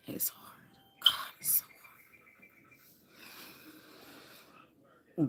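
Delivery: breathless whisper of despair; barely voiced, almost crying. Real, not acted. Overwhelmed, near tears.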